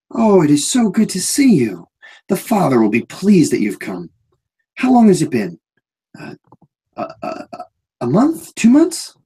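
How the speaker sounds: put-on a big man gushing like a child; odd overjoyed guard greeting a friend; eager childlike Anton. GM voices Anton.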